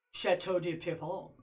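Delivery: Chateau de Pierrefonds said in a mock French accent, clipped and soft; lightly hammed French accent on Chateau de Pierrefonds; bad French accent. Shown as a demonstration. Streamer shows chat the easy way, mock French accent. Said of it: "it's easier to say".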